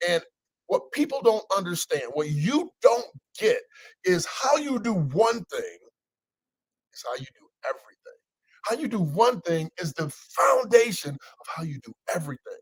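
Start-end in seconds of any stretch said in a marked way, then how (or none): 4.1-11.1 slow, weighty preacher delivery; slow emphatic sermon. Acted. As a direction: lean on each word slowly.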